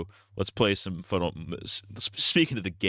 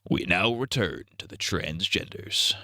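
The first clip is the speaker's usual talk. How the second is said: mock announcer; slower than usual. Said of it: "mock broadcast announcement".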